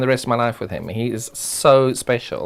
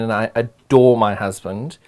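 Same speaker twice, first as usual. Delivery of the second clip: normal talking voice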